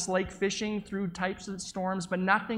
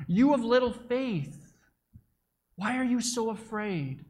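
loud over the storm; 3 semitones higher than usual. Acted. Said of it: "Jesus spoke loudly over the storm".

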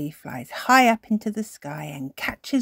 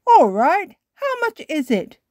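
put-on a touch of a cheerful old man, higher; lightly voiced grandpa; 12 semitones higher, 9 dB louder than usual. A narrator's reading. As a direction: grandpa asking the price, lightly played. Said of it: "Grandpa Pig says".

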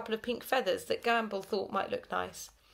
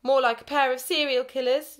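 yawned; 5 semitones higher, 8 dB louder than usual. A narrator's reading. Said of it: "yawned Missus Clegg".

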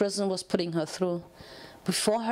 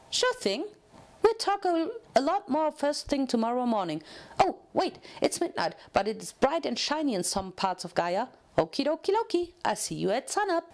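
chirpy, higher pony voice, full of cheer; eager cellmate chattering at a new arrival, chirpy and higher; bubbly Pinkie Pie; 5 semitones higher than usual. Acted. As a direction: bounce every word like an overexcited party pony.

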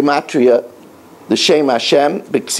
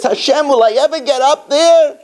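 hopeful plea; 11 semitones higher than usual. Acted. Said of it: "He says".

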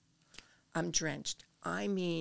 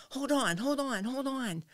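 high, insistent imitation of a paramedic; re-enacted emergency plea, pitched up; urgent paramedic; 7 semitones higher, 5 dB louder than usual. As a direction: urge a patient to hang on. Acted urgent paramedic grabbing her arm.

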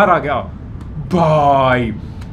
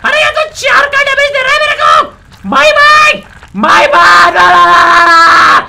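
panicked yelling; 9 semitones higher, 7 dB louder than usual. Real, not acted. The mahi mahi attacks, panic.

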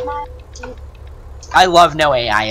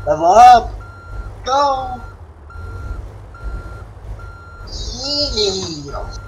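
excited cheer; 10 semitones higher, much slower than usual. Real, not acted. Celebrating level up in game.

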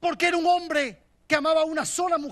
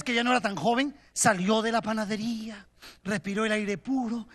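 ordinary talk, nothing marked

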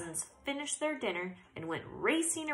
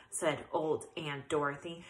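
plain reading voice

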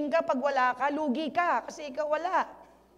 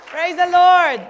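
loud, rousing "Praise the Lord"; rallying shout; 10 dB louder, slower than usual. Real, not acted. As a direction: lift the room with a shout. Pastor rallies the congregation.

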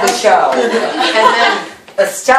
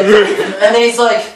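normal talking voice

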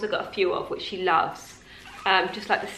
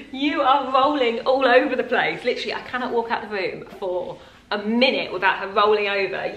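laughing; 3 dB louder than usual. Real, not acted. Mother laughing as her baby rolls across the floor, amused. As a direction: chuckle through the words.

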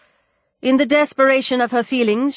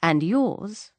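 ordinary delivery

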